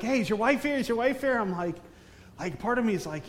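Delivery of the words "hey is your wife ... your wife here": mock-eager, raised pitch; higher, insistent imitation; imitated eager askers. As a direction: lightly mimic people pestering with a question. Imitates people pestering about his wife.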